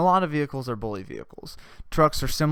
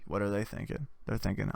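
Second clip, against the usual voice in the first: usual speaking voice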